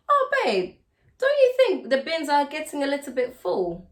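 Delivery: cooing wife asking sweetly; sweet babe ask. Shown as a demonstration. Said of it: "phrase your statements like it's a question".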